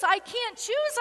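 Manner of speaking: high, climbing cry of refusal; rising desperate plea. A narrator's reading. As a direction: lift choose up in anguish. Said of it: "And she pleads".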